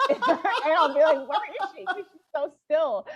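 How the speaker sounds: high, laughing while asking; giggling as you look around; laughing search. Real, not acted.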